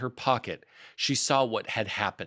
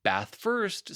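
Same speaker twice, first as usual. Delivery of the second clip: cheerful; 7 semitones higher than usual. Acted. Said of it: "in a cheerful voice".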